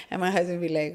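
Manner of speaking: usual speaking voice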